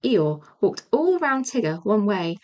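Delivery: usual speaking voice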